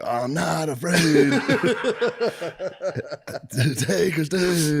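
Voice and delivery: mock [name]. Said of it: "start hitting the [name]".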